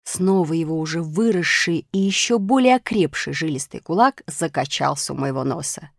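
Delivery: ordinary delivery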